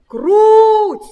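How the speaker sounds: long high loud call. A narrator's reading. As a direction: call the mice over, drawing out each name. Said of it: "позвал петушок".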